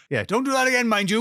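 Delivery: high, hammed-up Welsh scolding; lightly put-on Welsh accent. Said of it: "I've forgotten how to do a Welsh accent there".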